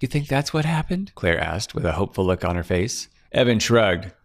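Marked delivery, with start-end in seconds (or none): 0.0-1.1 higher, relieved frightened woman's voice; frightened young woman. Acted. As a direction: play Claire, scared and clinging to hope. Claire voiced, hopeful.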